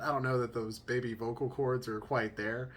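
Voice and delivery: ordinary delivery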